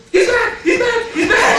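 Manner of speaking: high, loud triumphant yelling; excited shout. Retold or imitated. Trainee paramedic with paddles seeing him revive, high excited shouting. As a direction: cry out in relief and astonishment. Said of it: "and he goes".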